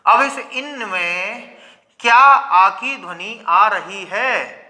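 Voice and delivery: very slow, spaced-out challenge; pointed slow questioning. Shown as a demonstration. Teacher challenging the rival definition before students, pointed slow questioning. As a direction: stretch each word to press the point.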